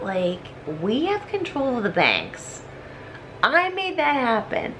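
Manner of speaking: ordinary delivery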